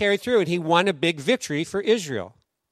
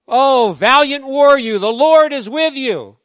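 raised, louder and slow, like a heavenly herald; angel's greeting; 7 semitones higher, 11 dB louder, slower than usual. Retold or imitated. Angel hailing Gideon the hardworking farmer, slow booming proclamation. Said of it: "an angel appears to him and says".

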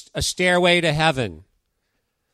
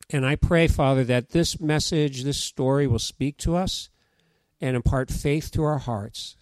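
prayer; 3 semitones lower than usual. Real, not acted. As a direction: pray it gently over the room. Pastor leads closing prayer.

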